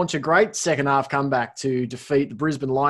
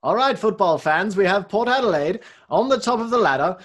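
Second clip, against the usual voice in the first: high, loud announcer for the football fans; put-on announcer voice; 6 semitones higher, 5 dB louder than usual. Host mock-announcing the ladder for an audio show, hammed-up announcer voice. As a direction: pitch it up like a hammed-up footy announcer. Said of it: "let's not do it in that voice".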